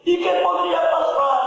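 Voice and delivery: high-pitched exclamation of shock; alarmed surprise. Acted. Listener hears Ramón is in hospital, high alarmed surprise. Neighbour reacts to hospital news.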